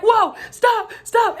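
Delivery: high, loud panicked yelp of whoa stop; startled cry for her to stop; alarmed shout. Retold or imitated. Attendants shouting at her twitching leg in a high, loud, panicked voice.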